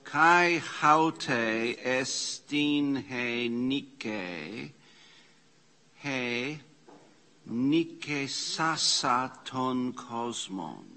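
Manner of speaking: each Greek word read out slowly and carefully. Shown as a demonstration. Teacher reads the Greek verse slowly to the class. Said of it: "It's an aorist participle active".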